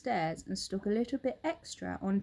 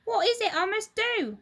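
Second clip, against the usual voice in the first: put-on high, loud, upset old wife; Mrs Twit crying out; 13 semitones higher, 6 dB louder than usual. Old wife cries out to her husband, high and alarmed. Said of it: "cried Missus Twit".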